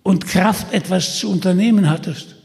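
lauter, höher spottender Versucher; verhöhn Peter mit schneidender Stimme; Holländer Michel, spöttisch. Acted. (Holländer Michel verspottet Peter, spöttisch und laut)

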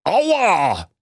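high, drawn-out ouch; pained yelp. Acted. Old miser dropped on a park bench, pained. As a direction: yelp from a hard landing.

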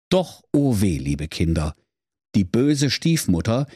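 plain reading voice

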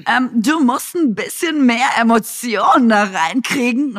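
mock director. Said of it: "hat wirklich die Regisseurin gesagt".

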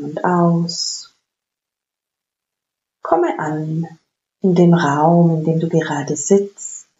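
slow, calm meditation voice; guided meditation. Acted. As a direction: guide them gently into stillness. Leads a guided breathing meditation.

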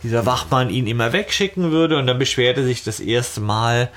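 normal talking voice

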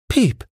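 a tiny, very high peep; sparrow chirp. A narrator's reading. One sparrow chirping to another, high peep. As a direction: chirp it like a cold little bird. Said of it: "sagte der eine zum anderen".

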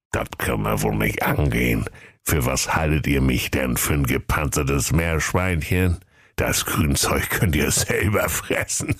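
low grumbling tortoise voice; indignant old tortoise. Acted. Tortoise rejects the dwarfs' gift, old and indignant. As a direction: refuse it as a crotchety ancient creature.

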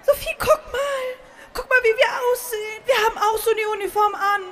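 high, fast, delighted squeal; thrilled. Acted. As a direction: gush with breathless excitement. Thrilled at Hogwarts uniforms. (begeistert und aufgeregt)

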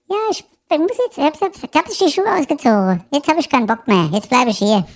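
high falsetto wife refusing to come; sulking wife voice. Acted.

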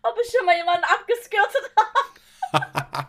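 laughing through the question. Real, not acted. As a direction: giggle the words out, incredulous. Amused disbelieving question about herself.

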